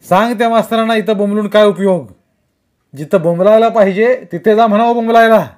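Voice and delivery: loud dismissive yelling; angry shout. A narrator's reading. Kumar shouting at Vaibhav about the teachers, loud and angry. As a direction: bark the order.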